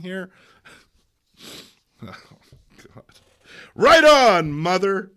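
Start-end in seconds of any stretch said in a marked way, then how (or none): 3.7-5.1 loud enthusiastic cheer; cheering youth. Acted. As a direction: cheer her on. Young person cheers.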